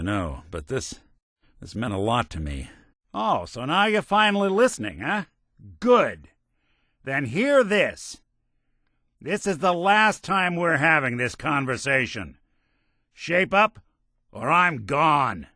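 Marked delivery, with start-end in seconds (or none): 3.1-5.3 higher, biting, fed-up jab; mock him for finally paying attention; sarcastic anger. Companion's angry ultimatum. 5.8-15.5 stern ultimatum. Acted. Companion's angry ultimatum.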